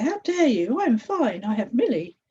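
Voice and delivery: high, loud offended protest; indignant outburst. A narrator's reading. Fear rejecting Hope's words, loud and indignant. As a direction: bristle with outrage.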